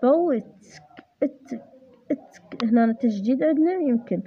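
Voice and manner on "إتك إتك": إتك whispered and isolated; stressed syllable shown. Shown as a demonstration. Tutor isolating the stressed syllable, whispered. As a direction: breathe out the syllable إتك softly. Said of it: "تشديد على الإيتك".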